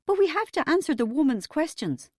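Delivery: high, pleading worry; nervous mother's protest; quavering. A narrator's reading. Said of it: "Mum quavered".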